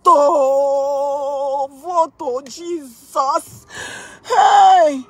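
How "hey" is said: panicked laughing cry. Real, not acted.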